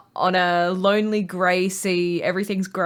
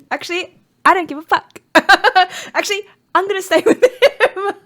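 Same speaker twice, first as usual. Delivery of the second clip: high, loud defiant voice; 11 semitones higher, 7 dB louder, faster than usual. Retold or imitated. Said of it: "all it did to her was go".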